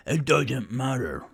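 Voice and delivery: Thornin's Scottish brogue; Scottish accent. Acted. Thornin the dwarf dismissing the excuse, Scottish accent. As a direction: roll it out with a Scottish lilt.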